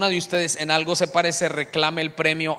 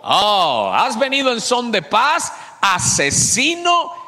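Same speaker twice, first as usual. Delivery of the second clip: higher, drawn-out cry of accusation; grito leído; 5 semitones higher, 4 dB louder, slower than usual. A narrator's reading. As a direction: read her taunting shout out loudly. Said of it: "ella le gritó". (grito acusador, agudo y alargado)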